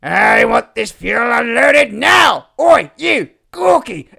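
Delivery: loud, slow barking order, pitch rising; bossy shout. Acted. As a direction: yell the order across the dock. Ordering fuel unloaded, shouting.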